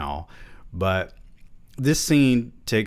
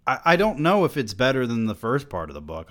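ordinary delivery

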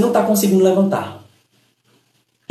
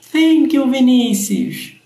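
agradecimento animado; 7 semitones higher than usual. Real, not acted. Agradece curtidas na live.

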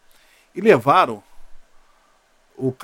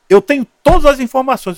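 emphatic retold claim; 9 semitones higher, 12 dB louder than usual. Retold boast on phone.

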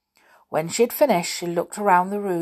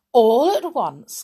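ordinary delivery, nothing marked

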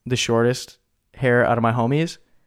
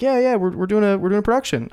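pitched-up imitation of the producer; breezy, high mock of the caller; casual producer; 9 semitones higher than usual. Producer explaining the shoot, high breezy mock. Caller voiced in retold call.

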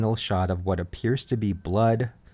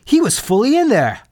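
high, louder shocked cry; retold shout; 13 semitones higher, 9 dB louder than usual. Camper reacting to the outhouse blast: high, louder shout of shock. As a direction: shout it out in horror. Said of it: "someone shouts".

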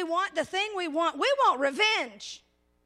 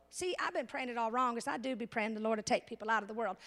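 quiet confessional aside; 7 semitones lower, 7 dB quieter, faster than usual. Real, not acted. Self-mocking confession aside.

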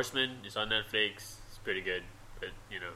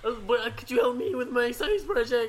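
higher, whiny, laughing; Morty voice; 8 semitones higher, 3 dB louder than usual. Pretend. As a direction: whine it up high through a laugh. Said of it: "I can do Morty".

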